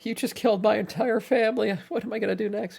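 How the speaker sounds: very high put-on voice; mock cook. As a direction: imitate the victim in a silly high pitch. Listener mockingly imagines the cook.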